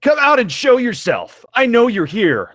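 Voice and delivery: high, loud challenge; yelled. Acted. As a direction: shout into the dark temple to draw him out. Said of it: "Link yelled".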